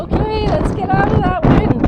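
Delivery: loud and high above the gusts; shouting over wind. Real, not acted. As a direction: call it out against the wind.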